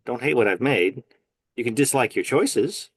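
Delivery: normal talking voice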